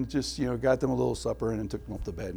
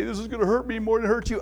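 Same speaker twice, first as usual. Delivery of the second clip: quoting dad; 8 semitones higher, faster than usual. Retold or imitated. Quoting dad before discipline.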